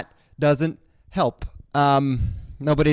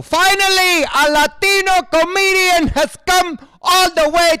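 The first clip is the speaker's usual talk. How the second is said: very high, loud, slow joyful fan voice; grateful fan; 14 semitones higher, 7 dB louder, slower than usual. Retold or imitated. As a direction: gush like an overjoyed fan. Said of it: "They all come to the show".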